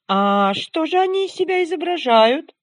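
high, loud snowman question; chattering melting snowman voice. Acted. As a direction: ask like a naive chattering snowman. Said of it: "спросил снеговик".

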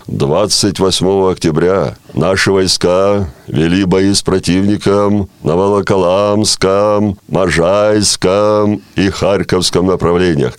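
slow, deep solemn state announcer; Levitan announcer voice. Acted. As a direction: read a war bulletin with grave solemnity. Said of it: "похожим на Левитана, и начинал говорить примеры так".

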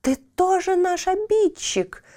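high angry chorus cry; angry trees shouting. Acted. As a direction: accuse him in outrage. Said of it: "гневно закричали остальные деревья".

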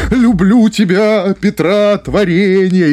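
slow, high, grandiose stage delivery; theatrical overacting. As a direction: declaim like a hammy stage actor. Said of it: "на Станиславского ты приходишь в театр". (театральная декламация)

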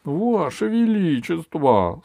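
high, slow, drawn-out address; loud stretched appeal to royalty; calling out. Acted. Alice calls to the stricken king. (протяжное обращение к королю)

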